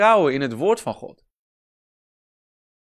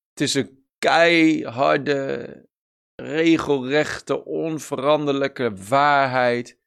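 each word slow and weighted; slow emphasis; slower than usual. Real, not acted. Preacher insisting to the congregation, slow and weighty. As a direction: lean hard into every word.

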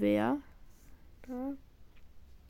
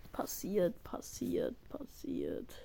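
softer until whispered; fading to whisper; 5 semitones lower, 9 dB quieter, slower than usual. Real, not acted. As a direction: let it fade to a whisper. Calming self after loss.

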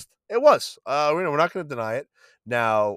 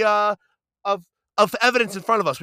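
ordinary talk, nothing marked

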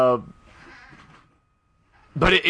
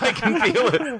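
laughing; 7 semitones higher than usual. Pretend. Echoing the Star Wars line, laughing.

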